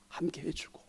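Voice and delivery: breathy whispered aside to the congregation. Real, not acted. Preacher whispering to his listeners. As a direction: let the word fall to a whisper.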